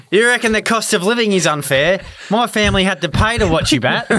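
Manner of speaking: mock on-field sledge; cheeky taunt, half laughing; bad acting. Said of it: "deliver it like we're out in the ground".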